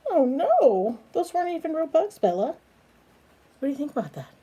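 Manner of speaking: ordinary delivery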